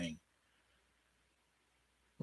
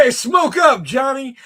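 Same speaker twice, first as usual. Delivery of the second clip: quoted shout; 12 semitones higher, 9 dB louder, slower than usual. Retold or imitated. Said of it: "He said".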